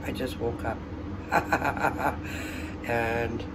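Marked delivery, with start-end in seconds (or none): 0.8-2.8 breathy, voiceless chuckle; laugh. Real, not acted. Greeting viewers just after waking up, laughing. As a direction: laugh in a quick run of soft puffs. Just woke to a failed batch.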